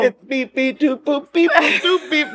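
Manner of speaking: rising beep boop beatbox; beatbox noises. Retold or imitated. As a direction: mimic a beat with beeps and boops. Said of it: "No, you would go in".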